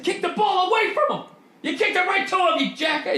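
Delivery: high-pitched, hammed-up coach ranting at a player; mock angry coach. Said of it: "like a head coach mad at halftime".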